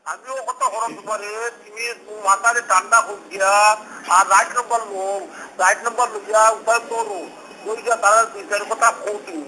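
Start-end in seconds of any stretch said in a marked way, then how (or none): none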